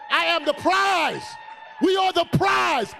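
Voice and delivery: triumphant shout; shouting proclamation. Real, not acted. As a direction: whip up the crowd with it. Crowd cheers, preacher shouts.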